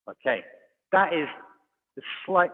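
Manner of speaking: ordinary talk, nothing marked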